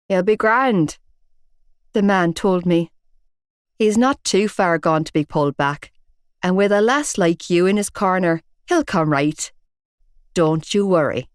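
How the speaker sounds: a hint of a gruff Belfast man; Belfast accent. Big bearded man telling off Shane, in a Belfast accent. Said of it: "distinctive Belfast accent".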